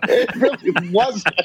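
words squeezed out slowly through laughter; laughing. Real, not acted. Laughing at the host's joke about the ghost dialogue. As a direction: laugh it off, barely getting it out.

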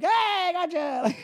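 high, drawn-out mock dad cheer; gleeful gotcha. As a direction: crow it like a prankster revealing the joke. Dad reveals the birthday prank.

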